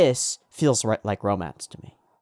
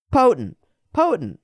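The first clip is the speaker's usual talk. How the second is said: high, punchy exclamation; emphatic exclamation; 10 semitones higher, 4 dB louder than usual. Real, not acted. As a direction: punch out the word with relish. Emphatic one-word verdict.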